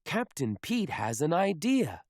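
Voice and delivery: usual speaking voice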